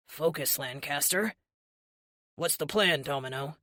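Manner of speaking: low, earnest hero voice; overly serious. A narrator's reading. Hero asks his partner for the plan, overly serious. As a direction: ask it with exaggerated gravity. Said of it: "in his overly serious voice".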